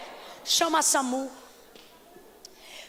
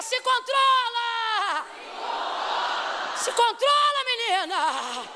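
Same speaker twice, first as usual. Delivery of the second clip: shouted command; 7 semitones higher, much slower than usual. Real, not acted. Preacher leads the church shouting se controla at a neighbour. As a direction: shout it at her to hold on. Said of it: "Olhe de novo e diga".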